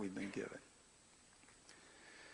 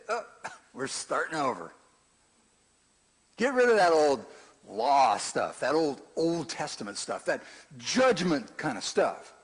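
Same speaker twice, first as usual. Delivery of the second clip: mock flippant Jesus; 6 semitones higher, 9 dB louder than usual. Imagined flippant Jesus quote.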